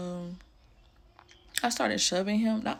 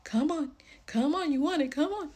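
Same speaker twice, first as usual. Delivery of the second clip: high-pitched luring, like calling a cat; coaxing; 7 semitones higher, 3 dB louder than usual. Retold or imitated. Woman luring her drunk partner outside like a cat, coaxing. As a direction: coax in rising, sing-song calls. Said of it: "coaxed him outside like a cat with some catnip".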